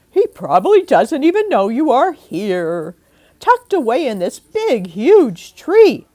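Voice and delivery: put-on rally the kids with a bright plan; Aunt Mim's voice. Aunt explaining her idea to the children, loud and bright.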